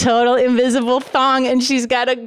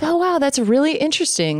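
mock interest; 6 semitones higher than usual. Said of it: "And I was like".

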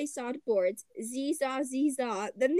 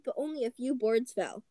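plain reading voice

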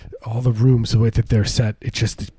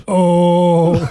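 short, louder high moan; moaning patient imitation; 7 semitones higher, 6 dB louder than usual. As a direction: let out a sudden moan of pain. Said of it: "he moans right at that moment".

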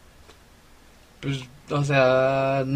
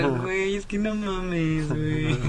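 high, drawn-out slangy chatter; mock valley-girl güey talk; high, slow mock slang; 5 semitones higher, slower than usual. Shown as a demonstration. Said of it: "así como de".

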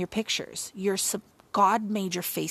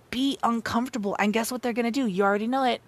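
normal talking voice

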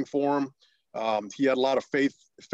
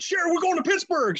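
much higher, bursting with the news; thrilled announcement. Retold or imitated. Husband telling wife Sherry about the call-up, thrilled and high. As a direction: blurt out the big news with joy. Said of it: "I said".

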